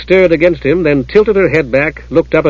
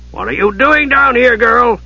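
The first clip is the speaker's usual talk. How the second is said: high-pitched stern questioning; demanding; 10 semitones higher than usual. A narrator's reading. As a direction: challenge the intruder in a raised pitch. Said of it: "Jack Ninefingers demanded, frowning".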